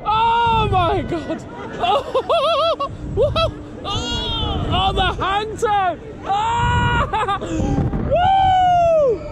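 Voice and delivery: screaming and whooping on a thrill ride; high yelps of fright and glee; on a roller coaster. Real, not acted.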